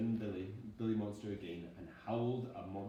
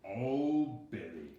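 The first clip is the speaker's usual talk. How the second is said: sleepy growl; 6 dB louder, much slower than usual. Acted. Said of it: "growled Daddy Monster sleepily".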